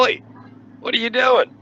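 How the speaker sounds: shouted Oi from the roof; yelling down. Retold or imitated. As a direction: call down loud and high at the cops. Yelling down at cops from truck.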